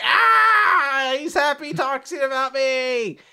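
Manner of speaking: high, loud squeal of joy; hammed-up delight, very high and drawn out; happy dog. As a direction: squeal like an overexcited puppy. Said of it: "like, a happy dog being like".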